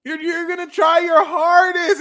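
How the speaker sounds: very high, loud teasing cry; excited squeal. Acted. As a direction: squeal it out playfully. Exaggerated teasing outburst.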